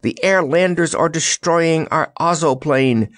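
high, urgent alarm; crying out. A narrator's reading. Scarecrow warns the sleeping wizard, crying out. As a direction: raise the alarm. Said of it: "cried the Scarecrow".

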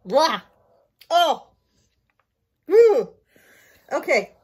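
gagging on food; talking with food in mouth; mouth full. Real, not acted. Seen 0: Chews mochi beforehand; grimaces, tongue out, gagging while speaking.

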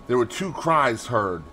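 ordinary delivery

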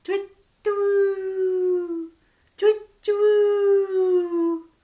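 high, loud, hooting call; owl hoot. A narrator's reading. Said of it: "went the owl".

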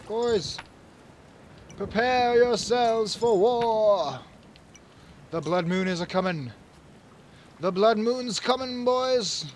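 a lightly played king, slow and solemn; king's war speech. A king addressing his soldiers before the blood moon, slow and solemn.

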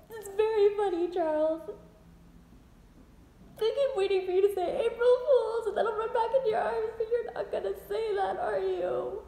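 high, crying voice; tearful. Acted. Wife hoping husband will say April Fools, tearful.

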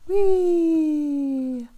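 put-on high delighted squeal of a child; gleeful whee. Little bear squealing on the ride up. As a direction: squeal with joy as you're lifted. Cub slides off shoulders.

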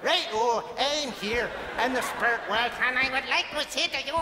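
put-on muddled accent while dancing; dancing Northern Irish-Mexican. Medium made to dance while doing dad's voice, muddled accent. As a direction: juggle the mock accent as if dancing. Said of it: "he loved to dance as well".

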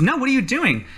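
high-pitched acted alarm; alarmed protest. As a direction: try to stop someone, voice jumping up. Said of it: "Elsie tries to stop you".

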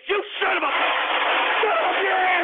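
hammed-up indignant Texan roaring back; a ranting host hams up offense at a listener's joke; outraged shout. Outraged over Texas Martyrs joke.

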